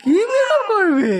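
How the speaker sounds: playful amazement. Pretend. Adult echoes a small child's makeup wish, playfully amazed. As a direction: ask in a high, playful swoop.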